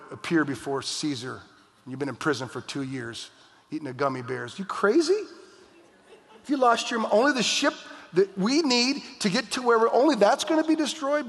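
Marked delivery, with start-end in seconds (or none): 4.6-11.3 high-pitched, exasperated comic disbelief; mock incredulity. Shipmate objecting to Paul about losing the ship, mock incredulity. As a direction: protest as if he's crazy.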